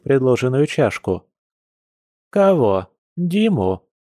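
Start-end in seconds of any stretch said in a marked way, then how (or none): 2.3-3.9 high, falling exclamatory questions; surprised. A narrator's reading. Grandma can't believe who is feared, surprised. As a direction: react in surprise, jumping up in pitch. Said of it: "удивилась бабуля".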